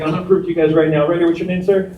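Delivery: ordinary talk, nothing marked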